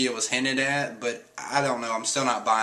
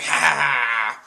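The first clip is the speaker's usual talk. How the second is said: loud acted villain cackle; villain laugh; 6 dB louder, slower than usual. Caribou boarding the ship to kill them, loud villain laugh. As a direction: belt out a mock evil laugh.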